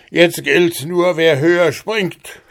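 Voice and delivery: laut und höher, höhnisch drohend; spottender Riese. Acted. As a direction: ein Riese, der den Knirps verlacht. Riese fordert Hans heraus. (höhnischer Riese, laut und höher)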